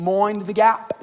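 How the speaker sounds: mock British accent, "Mind" said as "moin"; imitating a Londoner with "moin the gap"; lightly put-on British voice saying "Mind the gap"; fake British accent. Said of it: "me trying to have a British accent".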